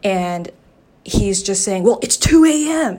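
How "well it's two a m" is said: higher, louder mock protest; mocking imitation. Telling friends about the neighbor's complaint, in a raised mocking voice. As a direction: mimic the neighbor's objection mockingly.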